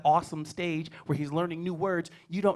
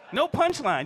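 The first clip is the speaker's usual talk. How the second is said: normal talking voice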